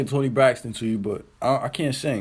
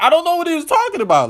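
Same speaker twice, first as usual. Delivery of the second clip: baffled; 12 semitones higher, 4 dB louder, faster than usual. Real, not acted. Baffled by the rant.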